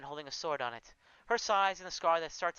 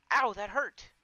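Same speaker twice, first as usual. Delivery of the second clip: high, loud yelp of pain; startled ow after a pinch; sudden pain; 6 semitones higher, 6 dB louder, slower than usual. Acted. Pinched by Valadress.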